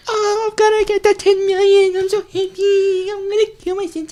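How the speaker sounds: put-on happy girl voice. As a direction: chirp it like a giddy schoolgirl. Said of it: "But she's real happy".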